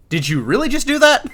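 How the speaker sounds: incredulous question, pitched high; mock-astonished, rising high in disbelief; retold disbelief. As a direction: ask it like you can't believe it.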